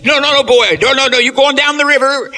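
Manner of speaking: high, loud hurried no, no, no; loud, high hunters waving the boy away; alarmed refusal. Retold or imitated. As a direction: back away in a panic, shouting them off. Slave hunters refuse, scared of smallpox.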